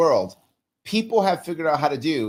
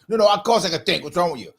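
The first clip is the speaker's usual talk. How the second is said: indignant reply; 5 semitones higher, 4 dB louder, faster than usual. Retold or imitated. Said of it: "acted indignant employee reply".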